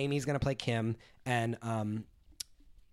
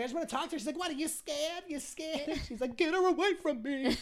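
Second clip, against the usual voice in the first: high, softer retold voices; quoted reality stars; 11 semitones higher, 5 dB quieter than usual. Retelling the chase, lightly voicing the stars high.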